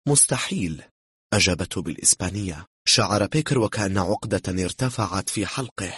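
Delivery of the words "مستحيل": higher, mocking disbelief; amused dismissal. A narrator's reading. Woman laughs off his police claim, amused dismissal. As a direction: brush it off as laughable. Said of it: "ضحكت".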